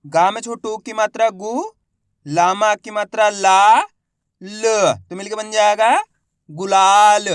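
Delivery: slow, loud spelling chant with stretched syllables; letter-by-letter reading. Shown as a demonstration. Teacher modelling word-building for pupils, letter by letter. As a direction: sound out each syllable, pause, then blend loudly. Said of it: "एक ही अक्षर का उच्चारण करके इनको पढ़ रहा हूँ".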